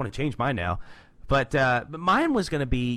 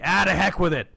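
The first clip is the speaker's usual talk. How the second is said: louder, higher burst of abandon; acted throwing caution aside; 5 semitones higher, 6 dB louder than usual. [name] giving up debating with himself, loud acted outburst. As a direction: blurt it out, done deliberating.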